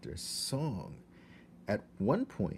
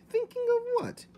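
much higher princess voice; a young girl asking, high; high little princess voice; 6 dB louder, faster than usual. A narrator's reading.

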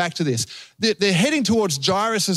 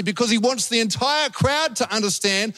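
usual speaking voice

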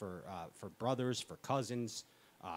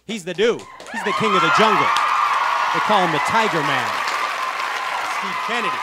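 hype announcer; 4 semitones higher, 12 dB louder than usual. Acted. Hype introduction of guest.

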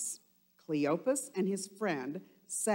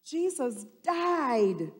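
frightened exclaim; 7 semitones higher, 6 dB louder than usual. Acted. Peter exclaiming in fear to Jesus, high and loud. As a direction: cry out that he died. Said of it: "exclaimed Peter".

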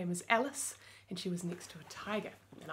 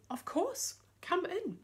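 polite mummy; 8 semitones higher, slower than usual. Acted. Mummy welcomes the tiger.

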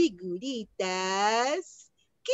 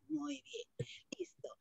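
whisper; 19 dB quieter than usual. Acted. Teacher whispering praise.

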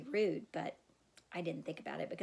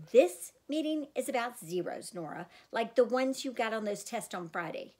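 louder, sharp rebuke; mother lays down the charge; stern mother scolding in the car; angry scolding; 4 semitones higher, 6 dB louder than usual. Acted. Mom scolding daughter in car.

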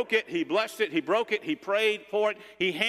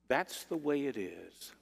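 soft, slow, reverent invitation; tender, hushed son; 5 semitones lower, 8 dB quieter than usual. Acted. Son inviting his mother to come and eat, tenderly. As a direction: draw it out quietly, sinking almost to a whisper.

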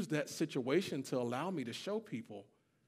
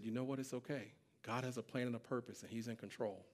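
much softer, slightly lowered comforting voice; calm reassurance; 3 semitones lower, 11 dB quieter than usual. Retold or imitated. Employee comforting the crying boss, calmly reassuring. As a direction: soothe gently, quiet and sure.

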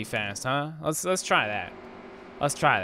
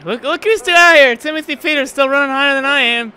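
higher and louder in real frustration; exasperated yell; 12 semitones higher, 11 dB louder than usual. Real, not acted. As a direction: shout it at the screen in disbelief. Yelling at rivals still racing.

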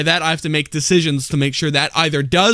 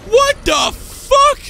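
very high, slow shocked yell; shocked scream; much slower than usual. Real, not acted. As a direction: scream it at a jump scare. Jump scare in the game.

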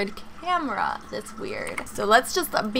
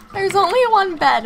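shocked; 9 semitones higher, 6 dB louder than usual. Real, not acted. Shocked realization.

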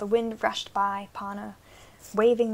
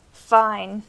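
loud angry snarl; 9 dB louder than usual. A narrator's reading. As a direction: bite the word out in anger. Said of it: "they snarled".

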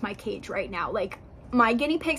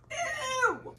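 disgusted; 13 semitones higher than usual. Real, not acted. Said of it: "I'm a bit dramatic".

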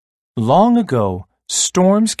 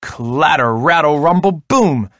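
rolling storybook rumble, building in pitch; dramatized clatter swelling toward the boom; thunder sound words; 2 semitones higher, 3 dB louder than usual. A narrator's reading. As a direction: make the words tumble into a boom. Storyteller voicing thunder of eggs.

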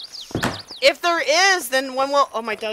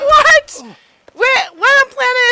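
high, loud, startled squawk; shocked disbelief; 10 semitones higher, 6 dB louder than usual. Real, not acted. Player baffled by the battle, shocked outburst. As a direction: burst out in disbelief.

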